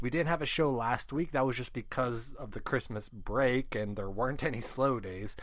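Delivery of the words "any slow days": ordinary talk, nothing marked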